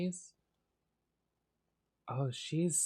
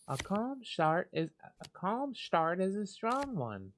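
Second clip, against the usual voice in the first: Luna's voice; 7 semitones higher than usual. Acted. Voicing Luna's card game taunt.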